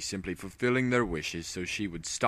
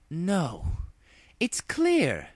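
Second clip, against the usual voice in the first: lightly voiced regal resolve; 11 semitones higher, much slower than usual. Luna decisive.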